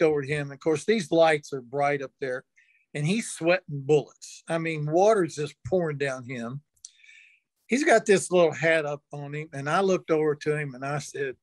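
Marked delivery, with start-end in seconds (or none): none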